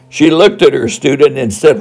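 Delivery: ordinary talk, nothing marked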